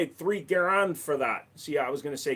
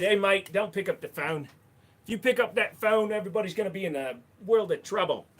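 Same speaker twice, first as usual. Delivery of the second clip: a hammed-up Aussie accent, louder; mock Aussie accent; 6 semitones higher, 5 dB louder than usual. Telling a mate off in a mock Aussie accent. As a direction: bluster through it in a put-on Aussie accent. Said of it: "use some little bit of slang".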